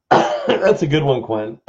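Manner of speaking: talking through hiccups; hiccuping between words. Real, not acted. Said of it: "I have the hiccups".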